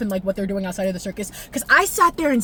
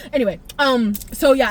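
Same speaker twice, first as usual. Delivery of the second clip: ordinary talk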